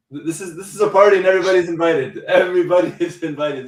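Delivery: high, loud, fast party call; joyful announcement. Real, not acted. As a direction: invite the world in.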